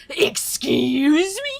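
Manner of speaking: slow, loud imitation of an offended friend; mock indignation. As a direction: draw it out, hammed-up and affronted. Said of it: "he's like".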